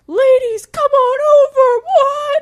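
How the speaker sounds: high loud catcall; partying guys calling. Retold or imitated. Guys partying on a deck calling to women from the bus, high and loud. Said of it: "they were like".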